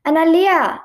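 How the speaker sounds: high, loud exasperated name-call; annoyed. A narrator's reading. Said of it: "I said, annoyed".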